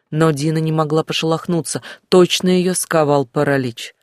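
usual speaking voice

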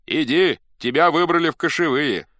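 high, commanding call; loud summons. A narrator's reading. Messengers summon the newly elected chief, calling loudly. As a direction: announce it as an order.

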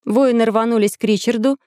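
usual speaking voice

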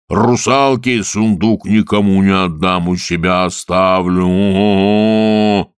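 slow, loud booming roar; bellowing water spirit. Acted. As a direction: boom across the swamp. (громовой голос Водяного)

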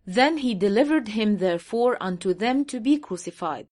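very slow, word-by-word English Bible reading; slow scripture reading. Shown as a demonstration. Reading the English verse aloud to his audience, very slow and deliberate. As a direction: lay out each word of the verse slowly.

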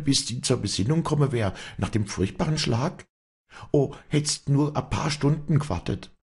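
high-pitched, distressed pleading; agitated reproach. A narrator's reading. Walli blames Vinzenz for not waiting, high and agitated. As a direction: reproach him with mounting anguish.

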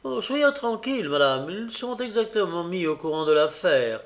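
high-pitched, grandiloquent assurance; pompous emphasis. A narrator's reading. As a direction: declaim it self-importantly, starting high. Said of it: "avec emphase". (ton emphatique, aigu et pompeux)

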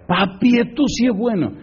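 high-pitched imitation of delighted kids; joyful children. Children see the books their father bought, imitated delight. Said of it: "brincaron de alegría".

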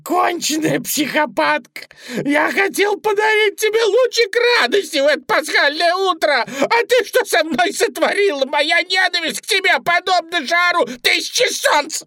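high, loud furious tirade; squealed, outraged rant; screaming rabbit. A narrator's reading. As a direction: shriek with hatred as the bunny. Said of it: "завопил кролик".

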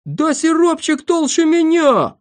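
high, loud protest; offended outcry at top pitch; indignant shouting. Acted. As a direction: squeal back in outraged disbelief. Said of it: "закричал Пончик".

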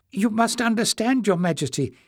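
put-on high old man's voice; an old librarian, hopeful and earnest; old Joseph, eager.

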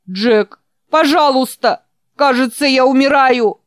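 high, loud desperate cry for help; helpless plea. Acted. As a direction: beg for your life. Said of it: "Беспомощный голос Ричарда".

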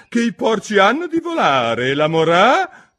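high, loud Duchess voice, broken off mid-word; imperious Duchess. A narrator's reading. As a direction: proclaim it high and loud, then stop short. Duchess voiced in narrated story.

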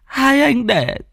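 high, drawn-out tearful pleading; tearful old mother. Acted. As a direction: plead slowly in a very high, weeping old woman's voice. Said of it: "nước mắt bà trào ra".

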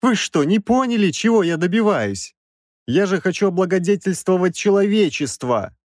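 high-pitched, outraged self-justification; indignant professor. A narrator's reading. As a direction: protest shrilly that you are misunderstood. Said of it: "возмущённо взвизгнул Краевский".

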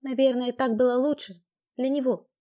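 ordinary delivery, nothing marked

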